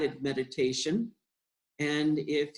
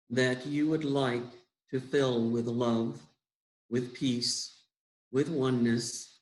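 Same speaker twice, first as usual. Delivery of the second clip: guided meditation; 2 semitones lower than usual. Acted. Leader guiding listeners into breathing and love, unhurried meditation voice. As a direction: guide them gently inward, phrase by unhurried phrase.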